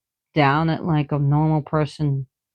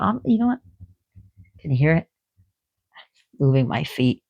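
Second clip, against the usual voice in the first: usual speaking voice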